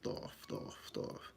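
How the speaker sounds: hushed, whispered chant of a spirit; mysterious voice. Retold or imitated. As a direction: whisper it over like an unseen voice. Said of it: "a voice started speaking to me".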